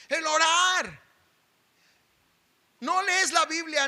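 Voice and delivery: fiery sermon shout; preaching at full force. Acted. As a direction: drive it home from the pulpit.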